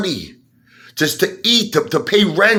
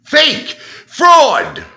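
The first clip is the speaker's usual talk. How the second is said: angry shouts; 10 semitones higher, 5 dB louder than usual. Real, not acted. Denouncing Lou.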